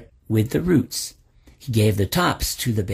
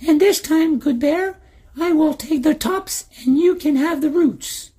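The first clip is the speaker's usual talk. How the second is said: put-on very high, sweetly polite old man; sly old man. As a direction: chirp it with fake courtesy. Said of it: "said the old man".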